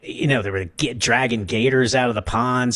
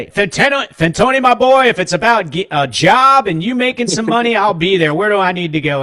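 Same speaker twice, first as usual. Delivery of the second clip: high, loud, eager fatherly voice; eager dad imitation; 6 semitones higher, 4 dB louder than usual. Dad on the phone promising to help his boy, high loud eager voice. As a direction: boom it out like a dad ready to help. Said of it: "calling your dad at the dentist office going".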